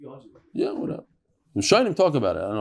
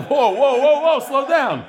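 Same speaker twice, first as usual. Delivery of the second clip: higher, laughing slow down; laughing whoa; 7 semitones higher, 4 dB louder, slower than usual. Real, not acted. The teacher laughs at the bossy wife, calming her.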